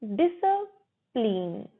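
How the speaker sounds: schwa after 'dis' in 'डिसिप्लिन', syllables held apart; broken into parts. Shown as a demonstration. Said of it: "डिस के बाद अ बोलते हैं".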